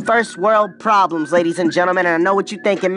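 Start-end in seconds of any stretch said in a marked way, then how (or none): 0.1-1.3 slow, drawn-out show title; grand title reveal; title announcement. Shown as a demonstration. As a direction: announce it like a big headline. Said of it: "today's episode title".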